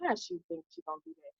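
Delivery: voicing what the brother secretly thought; imagined doubting brother. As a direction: toss it off like his private scoffing thought. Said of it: "I knew he was like".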